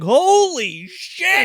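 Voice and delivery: mock-stunned gasp of words; shocked son. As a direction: stretch the horror out. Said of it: "and he's like".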